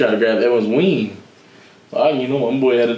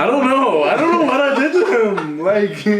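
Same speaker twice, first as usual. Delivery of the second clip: exasperated laugh; 8 semitones higher than usual. Real, not acted. Baffled, raised voice, laughing.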